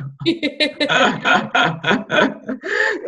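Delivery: high bursting laugh, real; big laugh. Real, not acted. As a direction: open delighted laughter. Laughing at her own joke.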